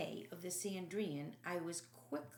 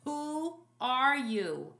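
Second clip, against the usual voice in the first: trembling voice; 2 semitones higher, 6 dB louder than usual. Acted. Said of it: "with ashen face and trembling voice".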